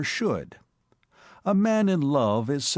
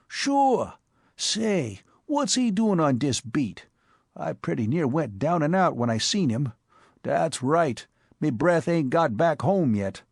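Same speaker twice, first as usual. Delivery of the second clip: higher, brash New York street voice; Bowery boy Spike; 4 semitones higher than usual. Acted. Spike breathlessly telling his boss about the cop, in a higher brash Bowery voice. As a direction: play the excitable Bowery tough.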